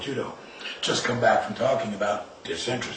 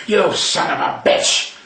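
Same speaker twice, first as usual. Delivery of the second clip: slow, louder, snarling growl; angry growl; 3 semitones higher, 5 dB louder, slower than usual. Retold or imitated. Angry parent growling at the child over the vase. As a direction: growl it out at the boy in anger. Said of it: "you point at him and you growl".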